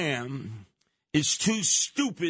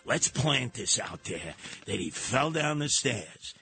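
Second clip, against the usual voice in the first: mock CIA guy; 8 semitones lower than usual. CIA agent planting a rumor, low sly scheming voice. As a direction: plot it like a sly spy. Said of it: "some C I A guy go".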